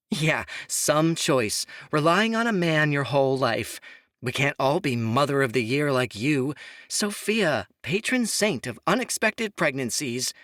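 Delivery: mock her with open scorn; scornful sneer. A narrator's reading. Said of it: "Imogen snorted".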